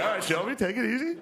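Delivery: high, teasing "take it easy"; calm him down, half laughing; mock scolding. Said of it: "You're like".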